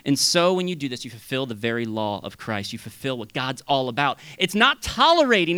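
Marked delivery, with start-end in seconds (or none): none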